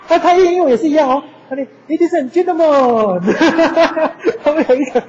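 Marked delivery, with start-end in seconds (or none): 1.9-3.2 put-on high, loud, slow Korean-accented English with a laugh; mock a Korean speaker's rising English. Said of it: "他有一个那个上扬的音". 3.2-4.4 laughing. Real, not acted. Laughing at own impression.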